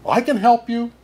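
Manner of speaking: high, sing-song little bird; tiny bird voice. Acted. Bird offering help to Anja, high and sing-song. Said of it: "sang a tiny voice".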